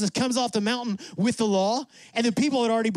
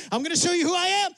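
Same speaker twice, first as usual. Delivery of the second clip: much higher, fast and eager; bursting to reveal himself; excited God; 10 semitones higher, much faster than usual. Acted. God telling the hidden Moses, higher, fast and eager. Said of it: "he's like".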